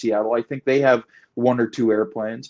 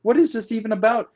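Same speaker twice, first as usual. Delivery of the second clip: mock disbelief in a raised voice; retold bafflement; 8 semitones higher, faster than usual. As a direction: replay your baffled reply, pitched well up. Retelling own baffled reply to officer.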